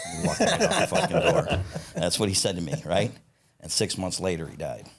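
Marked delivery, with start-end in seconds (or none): 0.1-1.9 high, laughing delivery of a punchline. Real, not acted. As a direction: crack up while finishing the story. Cracks up at his punchline.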